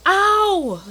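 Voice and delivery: loud, high yelp; yelp of pain. Acted. Monkey cries out when the dog nips his tail. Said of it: "John John cried".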